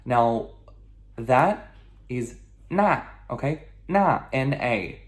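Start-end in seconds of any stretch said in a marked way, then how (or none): 2.7-3.0 "那" as na, falling steeply; fourth tone. Shown as a demonstration. Teacher introducing the word for that, fourth tone. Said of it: "fourth tone descending". 3.9-4.3 "那" as na in a firm descending tone; slow, falling "那"; fourth tone. Shown as a demonstration. Teacher repeating before spelling it, fourth tone. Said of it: "fourth tone descending".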